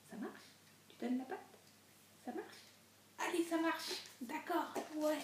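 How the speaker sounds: talking to a dog. Real, not acted. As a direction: coax the dog to give its paw. Coaxing the dog paw.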